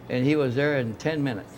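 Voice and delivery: normal talking voice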